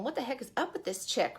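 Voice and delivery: a snide murmur from the crowd; muttered snark. Acted. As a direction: mutter it behind her back with scorn.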